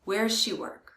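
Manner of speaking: "does" shrinks to a z sound in "Where does she work?"; quick "Where's she work"; does reduced. Shown as a demonstration. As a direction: say "does" like "'s", fast. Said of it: "They sound exactly the same".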